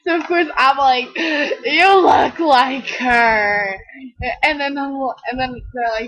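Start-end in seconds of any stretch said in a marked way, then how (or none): 1.1-3.8 drawn-out excuse. Retold or imitated. Explaining herself to the stranger.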